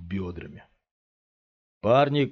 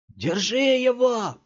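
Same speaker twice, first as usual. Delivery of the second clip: high, loud, slow bellow; roared order; 6 dB louder, slower than usual. A narrator's reading. Thug in cap roars to his friends to hold Scott. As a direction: roar it at your friends. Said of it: "проревел парень в кепке".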